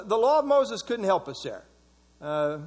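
ordinary delivery

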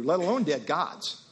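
normal talking voice

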